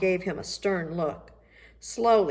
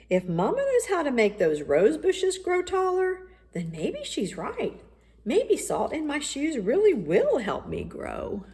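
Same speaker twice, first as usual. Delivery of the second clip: excited realization; 9 semitones higher, 3 dB louder than usual. Acted. Michael excited by his idea.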